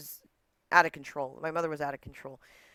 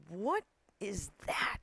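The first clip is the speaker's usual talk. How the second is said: drawn-out, higher, baffled question; 5 semitones higher, 3 dB quieter, slower than usual. Retold or imitated. Daughter hearing mom's new accent, baffled. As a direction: stretch it out in mock disbelief.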